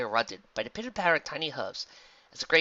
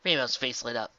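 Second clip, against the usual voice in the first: usual speaking voice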